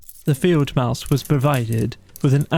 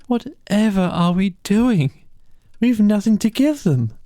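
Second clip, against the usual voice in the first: higher, despairing near-tears plea; 9 semitones higher than usual. Acted. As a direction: fret helplessly, close to crying. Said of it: "with tears impending".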